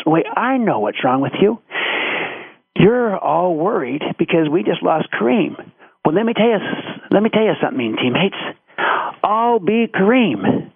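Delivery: retold pep talk, climbing in pitch; acted rallying speech. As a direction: play the confident rookie rallying the team. Magic rallies team, building to vow.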